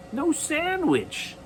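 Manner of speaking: high, soft, slow dismay at the missing sandwich; dismayed echo. A narrator's reading. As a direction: repeat it in disbelief, high and soft. Narrator echoes dismay.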